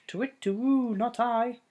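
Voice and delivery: high owl hoot, to wit, to woo. Acted. Owl answering the Gruffalo's child, a high hooting voice.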